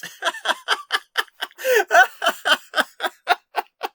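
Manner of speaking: long high burst of real laughter; helpless laughter. Real, not acted. Vlogger cracking up at a comment admitting she never read the article. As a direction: laugh out loud, unable to stop. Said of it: "I can't stop laughing".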